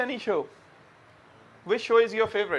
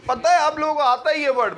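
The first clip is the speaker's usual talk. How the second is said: loud coaxing; 8 semitones higher, 7 dB louder than usual. Real, not acted. Teacher coaxing the class.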